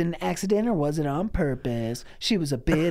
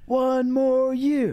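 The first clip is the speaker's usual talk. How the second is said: mock pleading; 8 semitones higher, 4 dB louder, slower than usual.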